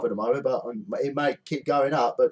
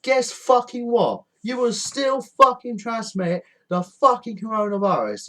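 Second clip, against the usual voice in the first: angry retort; 8 semitones higher, 3 dB louder than usual. Real, not acted. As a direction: snap back at them. Rebuking the holidaymakers.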